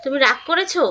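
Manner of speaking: high, cooing questions with rising ends. Real, not acted. Mother asks sulking child, cooing. Mother coaxing silent toddler.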